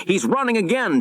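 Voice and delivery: high urgent cry; cried out. A narrator's reading. Darling crying out as the beast runs again. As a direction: yell the warning up high. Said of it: "Darling cried".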